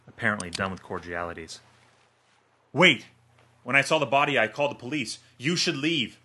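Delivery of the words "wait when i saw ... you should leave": high, loud urgent shout; desperate yell. A narrator's reading. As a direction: yell to stop the trigger pull. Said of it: "Dan yells".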